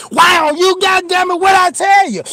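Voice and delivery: high, loud scolding yell; furious mama yell. Retold or imitated. Mama whipping him, high furious scolding yell. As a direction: scold like mama mid-swing.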